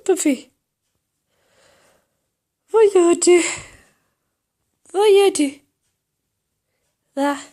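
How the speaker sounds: high-pitched sing-out to a pet; high, playful pet-calling; calling the cat. Real, not acted. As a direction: call the cat over in a high voice. Calling and cooing to her cat.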